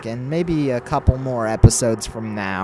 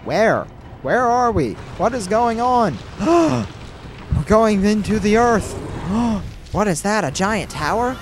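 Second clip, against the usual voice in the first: excited; 10 semitones higher, 3 dB louder than usual. Real, not acted. Amazed by game cutscene.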